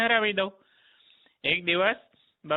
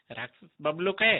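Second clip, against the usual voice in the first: plain reading voice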